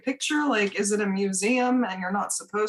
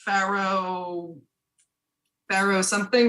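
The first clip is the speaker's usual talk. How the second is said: ordinary talk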